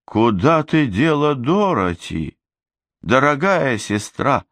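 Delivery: cooing. Acted. Glamora asks her sister about Dorothy, slow mock-sweet coo. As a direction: coo it with false tenderness. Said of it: "проворковала Гламора".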